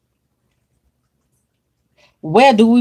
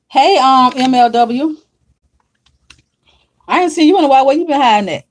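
higher, louder happy welcome; excited greeting; 3 semitones higher, 3 dB louder than usual. Real, not acted. Host greeting a long-missed viewer, excited.

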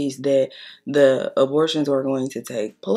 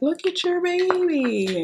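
much higher and slow, sugary cooing imitation; mock cooing nurse; 13 semitones higher, slower than usual. Imitating the ultrasound tech cooing at her, mock sweet. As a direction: coo it like a gushing ultrasound tech. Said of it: "she's like".

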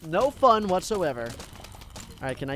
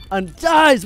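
excited; 9 semitones higher, 12 dB louder than usual. Real, not acted. Zombie killed by cannon.

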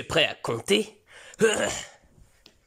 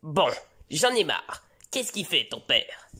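normal talking voice

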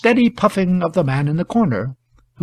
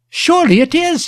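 high, louder blurt; involuntary outburst; 13 semitones higher, 5 dB louder than usual. A narrator's reading. Shepherd answers about the man, involuntary outburst. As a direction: let it burst out, high-pitched. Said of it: "said the shepherd involuntarily".

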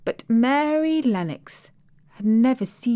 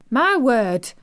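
bright, raised, delighted exclamation; delighted cry; 5 semitones higher, 5 dB louder than usual. Acted. Maid thrilled by stories of India, delighted Yorkshire cry. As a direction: burst out with delight, Yorkshire accent. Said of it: "cried delighted Martha".

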